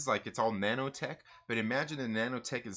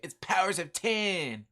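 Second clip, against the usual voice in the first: higher, slow, drawn-out mocking correction; exaggerated objection, stretching the words; mock heckler; 6 semitones higher, 4 dB louder, slower than usual. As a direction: imitate a know-it-all viewer butting in. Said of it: "somebody out there is gonna be like".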